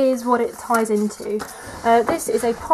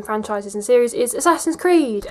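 usual speaking voice

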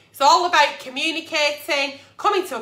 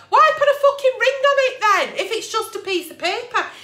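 exasperated outburst; 7 semitones higher than usual. Real, not acted. Exasperated at partner's logic.

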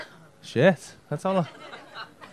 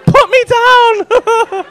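put-on high, drawn-out squeal of a carried girl; mock girly squeal; 4 dB louder, much slower than usual. Comedian playing a girl carried home by a rugby player, high slow squeal. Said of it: "Just fucking".

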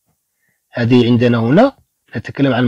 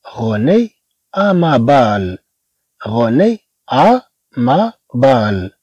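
René à ma balle drawn out very slowly; slow teaching demo; much slower than usual. Shown as a demonstration. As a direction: read it slowly for the pupils to repeat. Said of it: "ثم".

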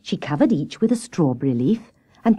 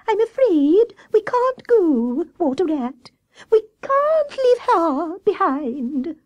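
very high, slow squirrel voice; high, drawn-out, sorrowful little creature; Squirrel, pleading; 13 semitones higher, slower than usual. Acted. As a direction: plead plaintively in a high squeak. Squirrel character voice.

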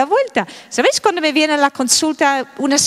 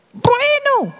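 exasperated exclaim; 11 semitones higher than usual. Real, not acted. Exclaiming about child's tantrum.

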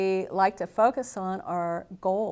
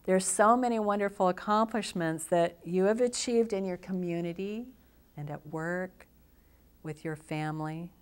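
guided visualization. Acted. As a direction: guide them gently into relaxation, phrase by phrase.

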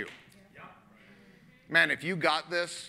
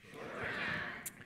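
whisper; 13 dB quieter than usual. Shown as a demonstration. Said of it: "Everybody say".

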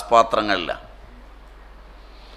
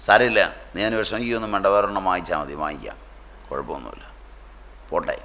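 soft, fading reassurance from a mother; mother telling her child not to worry; consoling mother; 3 semitones lower, 5 dB quieter, faster than usual. Retold or imitated. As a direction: soothe the child, voice dropping much softer to almost nothing. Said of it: "യാതൊരു വഴക്കും പറഞ്ഞില്ലെന്ന്".

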